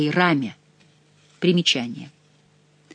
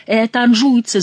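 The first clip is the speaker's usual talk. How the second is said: higher, louder accusing cry; shouting; 6 semitones higher, 5 dB louder than usual. A narrator's reading. Favourite blaming the Angevins, shouting. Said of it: "закричал Келюс".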